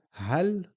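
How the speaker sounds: "hal" as one syllable ending on L; stop on the L. Shown as a demonstration. Teacher comparing sukoon with fatha, final L stopped. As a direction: close "hal" on the L with no vowel after. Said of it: "we are only pronouncing the sound".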